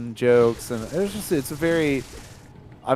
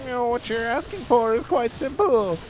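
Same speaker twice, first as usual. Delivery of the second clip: frog voice; 11 semitones higher than usual. Acted. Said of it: "I accidentally am doing a legendary frog voice".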